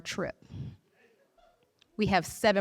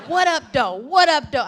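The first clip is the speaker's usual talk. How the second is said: loud, high bellowed Detroit greeting; loud drunk greeting; 7 semitones higher, 9 dB louder than usual. Retold or imitated. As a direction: yell it like a rowdy drunk. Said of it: "loud as fuck".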